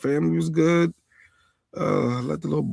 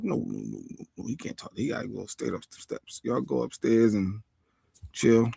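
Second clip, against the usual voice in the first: hushed mock order; 4 semitones lower, 8 dB quieter than usual. Dad ordering boyfriend upstairs.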